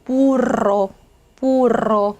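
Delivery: 부르 with a long tongue trill into ro; rolled r. Shown as a demonstration. Teacher modelling double r, 부르 strongly trilled. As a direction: roll the r at length in 부르. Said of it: "얘 발음이 어떻게 돼요?".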